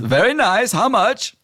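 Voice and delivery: high nasal-free genie. Acted. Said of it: "Genie says".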